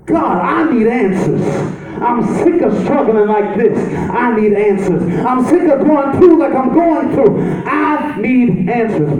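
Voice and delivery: rising, impassioned preaching; preaching build. Acted. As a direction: cry out to God for answers.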